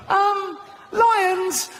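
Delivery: high squeaky goat voice; timid goat. Pretend. Goat answering the interviewer, high and timid. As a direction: answer like a nervous little goat.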